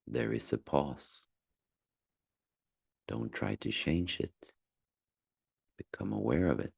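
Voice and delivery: calm, spacious delivery with long silences; unhurried meditation voice, phrase by phrase; guided meditation. Acted. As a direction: gently guide someone through noticing their breath. Leads a guided breathing meditation.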